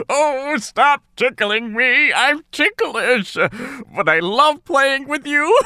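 giggling through the words; a big bear squirming with laughter; laughing bear. Acted. Bear tickled by the sisters, giggling.

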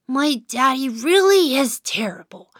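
ordinary delivery, nothing marked

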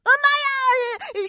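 slow, high frightened yelp; scared cry. Acted. Shark grins, narrator frightened. (吓得惊叫)

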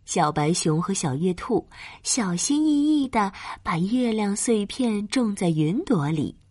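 ordinary delivery, nothing marked

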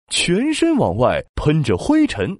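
ordinary delivery, nothing marked

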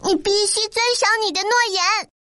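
high, fast demand; rapid high-pitched protest; insistent cry. A narrator's reading. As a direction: call it out urgently in a high voice. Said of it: "陶乐斯高声叫着".

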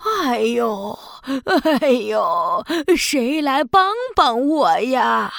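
put-on slow groaning old woman; old granny voice. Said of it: "海女巫变成了一位老奶奶". (假装摔倒的老奶奶声音)

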